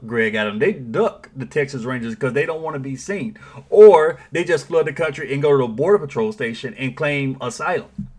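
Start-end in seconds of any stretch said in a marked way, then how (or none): none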